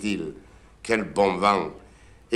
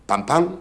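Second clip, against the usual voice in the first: high spoken knock-knock; knock imitation; 8 semitones higher, 3 dB louder than usual. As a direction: tap the door with your voice.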